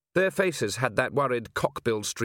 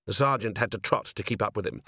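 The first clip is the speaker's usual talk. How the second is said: plain reading voice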